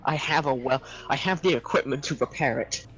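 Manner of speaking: ordinary talk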